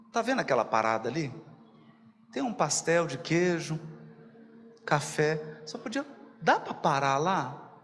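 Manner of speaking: low, lightly acted pleading passenger; meek request. Passenger asking Jesus for a snack stop, mock meek request. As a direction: ask small and low, hoping for a yes.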